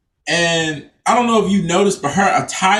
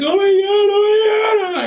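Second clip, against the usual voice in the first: much higher, frantic hammed-up shriek; shrill fake terror; mock panic; 14 semitones higher than usual. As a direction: overplay her panic at the dog. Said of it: "she's like".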